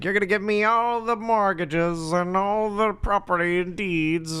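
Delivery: high, slow, drawn-out villain voice; villain's demand. Retold or imitated. Villain to a broker tied on train tracks, drawn-out gloating demand. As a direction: draw out every word like a melodrama villain. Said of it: "he ties the broker up".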